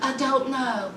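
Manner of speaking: low, soft mock-sheepish answer. Woman confronted by her boyfriend, sheepishly quiet.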